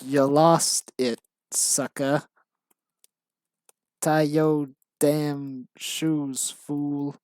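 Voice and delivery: slow mock gangster, drawn-out words; hammed-up gangster, word by word. Writer reading out own embarrassing script, mock gangster. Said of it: "stereotype of how gangsters talk".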